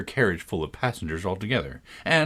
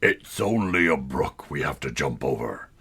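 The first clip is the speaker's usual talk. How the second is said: much lower calm horse voice; 8 semitones lower than usual. A narrator's reading. Horse calmly reassuring passengers, much lower. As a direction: settle the startled carriage. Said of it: "quietly drew it in and said".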